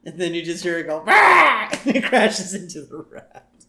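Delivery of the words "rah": high, loud bird shriek; imitated squawk of a doomed bird; seagull squawk. Co-host imitating the seagull going down, loud squawk.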